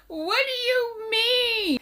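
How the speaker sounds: high, long drawn-out cry of disbelief; disbelieving wail. Real, not acted. Co-host reacting to the no-drink date story, astonished wail. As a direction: stretch it out high, utterly astonished.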